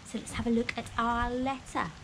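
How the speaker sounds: ordinary talk, nothing marked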